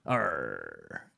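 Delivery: low pirate "Arr"; pirate growl. Pretend. Streamer greeting a pirate-named viewer, pirate growl. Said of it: "Wanna Beer the Pirate Man".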